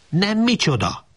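snapping back. A narrator's reading. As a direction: cut him off sharply. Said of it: "Csapott le rá az asszony". (élesen, jóval magasabban lecsap)

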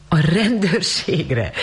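high, drawn-out incredulous echo; slow, high, amused astonishment; amused disbelief. A narrator's reading. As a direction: repeat it slowly in high-pitched amazement. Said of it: "Rosemary felkacagott".

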